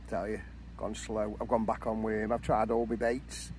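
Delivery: normal talking voice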